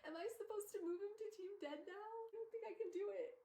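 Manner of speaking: high-pitched, helpless protest; overwhelmed fan in despair; acted despair. Acted despair over a character's death.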